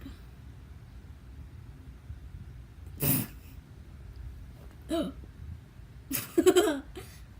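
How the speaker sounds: silent wheezing laugh; helpless airy laughter; breathless giggle. Real, not acted. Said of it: "I'm laughing so hard".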